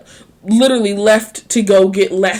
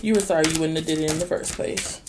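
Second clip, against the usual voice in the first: flat dismissal; 5 semitones lower, 8 dB quieter, faster than usual. Real, not acted. Dismissing his apology.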